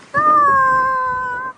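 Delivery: high, loud hype call; excited shout. Real, not acted.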